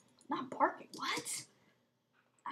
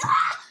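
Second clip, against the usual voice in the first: short loud startled scream; retold scream; 18 dB louder than usual. Shocked at the gift, a sudden scream. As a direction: let out a sudden shocked yell. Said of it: "I screamed, I went".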